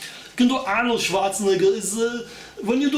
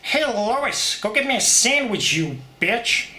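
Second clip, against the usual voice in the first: Peter Griffin; 4 semitones higher than usual. Retold or imitated. Peter ordering Lois to fetch a sandwich, cartoon voice. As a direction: bark the order like a lazy cartoon husband. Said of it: "Maybe you can do Peter Griffin".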